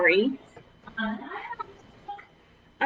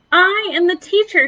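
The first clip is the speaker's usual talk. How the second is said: bossy little teacher; 8 semitones higher, 6 dB louder than usual. Acted. Lily playing teacher to brother.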